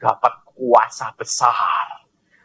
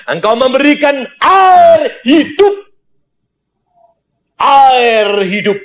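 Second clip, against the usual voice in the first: loud, high fervent preaching; fiery sermon voice; preaching at full force; 12 semitones higher, 4 dB louder than usual. Acted. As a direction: drive it home to the congregation. Preacher exhorting congregation.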